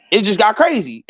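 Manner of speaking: ordinary delivery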